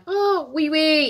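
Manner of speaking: high, quick oui oui; mock French reply. Imitating a French local's reply, high and breezy. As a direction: toss off the French yes lightly.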